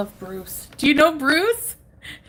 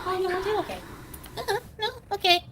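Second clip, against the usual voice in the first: high cooing voice, much higher; 10 semitones higher, 5 dB quieter than usual. Real, not acted. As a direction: coax the pet sweetly. Talking to her pet duck.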